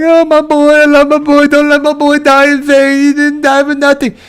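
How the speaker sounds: high, hammed-up wailing; mock crying. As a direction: put on a super fake, high-pitched tearful plea. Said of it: "She's crying and she's like".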